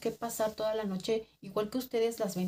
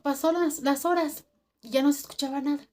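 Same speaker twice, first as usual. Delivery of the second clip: ordinary delivery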